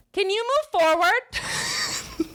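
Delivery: high-pitched, pointed demand; shrill-high mock yell into someone's ear. Annoyed fan to the girl in front, high mock yell.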